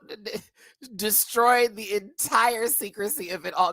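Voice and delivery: normal talking voice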